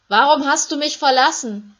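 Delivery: Jesus screaming in abandonment on the cross; anguished scream. Acted. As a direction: scream the question to God. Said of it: "Da hören sie Jesus schreien".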